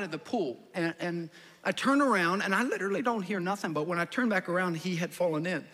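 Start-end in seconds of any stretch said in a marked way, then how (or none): none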